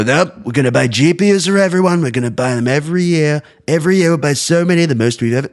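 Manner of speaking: high-pitched mocking politician impression; Trump. Host ridiculing the idea of endless GPU purchases, mock Trump voice. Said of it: "Trump is just gonna go".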